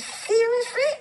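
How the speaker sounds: high, loud, rising disbelief; incredulous. Retold or imitated. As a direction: re-enact an indignant, disbelieving comeback. Her shocked reply in retold quarrel.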